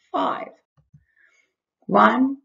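ordinary talk, nothing marked

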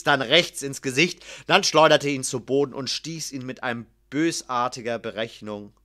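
plain reading voice

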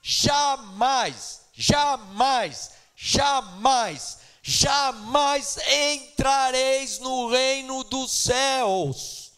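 loud, drawn-out jamais, pounding each one; thundering preaching. Acted. Preacher warning the church they will never enter heaven, loud and slow.

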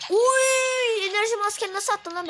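high, loud startled yelp; sudden shocked yell; shocked shout. Real, not acted. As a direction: yelp at the screen. Reacting to a play.

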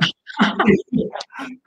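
high, breathy burst of laughter; real burst of laughter. Real, not acted. Laughing to the listener at the bloke's absurd ticket request.

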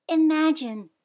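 bright high "Imagine"; eager gasp of a word; excited exclaim. Acted. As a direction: lift it with wonder. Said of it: "she said".

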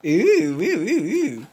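ordinary delivery

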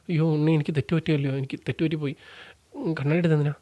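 mock-sorrowful whimper; sad pleading. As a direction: imitate a man begging forgiveness, almost crying. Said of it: "സങ്കടവും ഒക്കെ വരാമല്ലോ".

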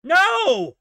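high, loud cry of protest; anguished no. Real, not acted. Gamer howling no at a bad turn. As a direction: yell no at the screen. Game setback.

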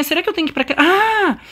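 ordinary talk

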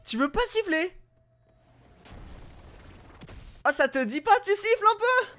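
high, loud whiny pleading at the referee; exasperated pleading. Real, not acted. Gamer begging the referee to end the half, high and whiny. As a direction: beg the referee to whistle.

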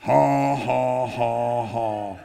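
a lightly acted slow ha ha ha; mock laughter, fading out; retold laughing. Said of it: "he laughed for twenty minutes like that".

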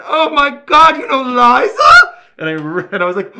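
hammed-up fan shriek, very high and loud; starstruck screaming. Seeing the signed Liza photograph at the audition, starstruck scream. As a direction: flip out over a celebrity, full-volume squeal.

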